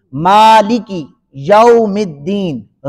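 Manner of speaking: مالکی یوم الدین with lengthened vowels; مالکی یوم الدین recited slowly before translating; slow verse reading. Shown as a demonstration. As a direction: draw out "maa" and "deen" in مالکی یوم الدین.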